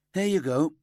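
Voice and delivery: chatty section leader showing the detective in. A narrator's reading.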